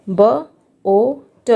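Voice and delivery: "ब ओ ट" split into slow separate sounds. Shown as a demonstration. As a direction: sound out ब, ओ, ट one at a time, very slowly. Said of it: "बोट". (ध्वनियाँ अलग-अलग धीरे-धीरे)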